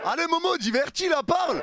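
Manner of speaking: high loud teasing call; egging on. Real, not acted. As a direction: goad him loudly. Egging friend on.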